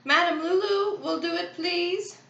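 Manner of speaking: high, projected put-on voice; booming announcement. A narrator's reading. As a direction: announce it to the whole crowd. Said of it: "announced in a booming voice".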